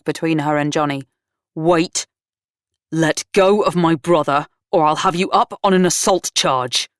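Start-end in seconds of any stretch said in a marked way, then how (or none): 1.5-6.9 a touch of stern command; firm order to back off; authoritative. A narrator's reading. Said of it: "the authority in his tone".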